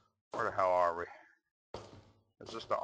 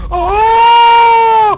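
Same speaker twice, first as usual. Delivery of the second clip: piercing high terrified scream; real scream of fright; 13 dB louder than usual. Real, not acted. Gamer jump-scared by Slender Man, screaming in terror. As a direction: scream as the monster lunges.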